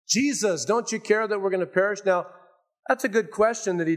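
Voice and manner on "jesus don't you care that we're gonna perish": high, anxious complaint; desperate plea. Acted. Disciples accusing the sleeping Jesus, anxious and high. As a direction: plead as if he doesn't care.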